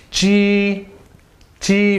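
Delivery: "G" held high and steady; high level first tone. Shown as a demonstration. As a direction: sustain "G" on one high pitch. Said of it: "First tone".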